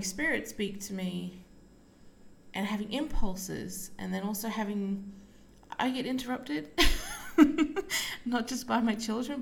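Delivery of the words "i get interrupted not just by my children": laughing it off, higher pitch; amused laugh through the words. Real, not acted. Laughs about constant interruptions.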